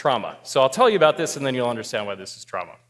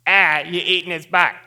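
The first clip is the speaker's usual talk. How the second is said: put-on Boston accent, louder and aimed straight at you; 5 semitones higher, 8 dB louder than usual. Stranger addressing the diner directly, loud Boston accent. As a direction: call it across the deck in Boston accent. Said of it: "now directly at me".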